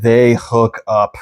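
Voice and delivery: very slow, each word stretched; drawn-out climax. Real, not acted. Storyteller landing the hook-up ending, drawn out slowly. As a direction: stretch out the big reveal.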